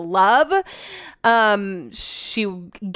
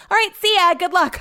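breezy farewell; 9 semitones higher than usual. Retold or imitated. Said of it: "I'm like".

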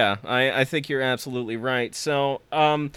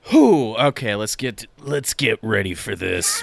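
normal talking voice

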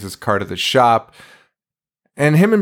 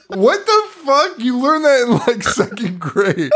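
incredulous; 11 semitones higher than usual. Real, not acted. Disbelief at the remembered line.

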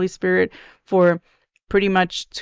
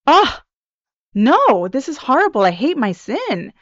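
indignant alarm; 5 semitones higher than usual. Acted. Voicing a repentant sinner appalled by their sin, indignant and alarmed. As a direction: cry out in acted horror, voice swooping high. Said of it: "when you're indignant and you're in alarm, you're like".

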